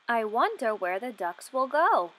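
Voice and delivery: usual speaking voice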